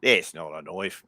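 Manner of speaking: low, slow Australian drawl; Australian accent. Pretend. Showing friends one of her accents, low slow Australian. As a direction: sneer at the little knife in an Australian accent. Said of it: "That's a good Australian".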